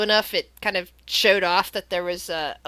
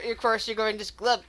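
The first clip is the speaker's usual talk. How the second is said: ordinary talk, nothing marked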